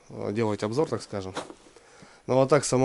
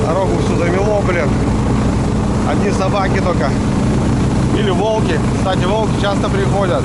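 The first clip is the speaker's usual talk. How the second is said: raised, higher voice calling out over a roaring motor; shouting over the engine; 10 semitones higher, 7 dB louder than usual. Real, not acted. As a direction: shout each line to be heard above the snowmobile. Talking while driving loud snowmobile. (громко, перекрикивая мотор снегохода)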